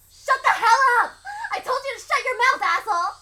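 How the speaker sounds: high, loud screaming rage; furious shout. Acted. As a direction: scream her down in raw hostility. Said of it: "Rena's raw, hostile emotion".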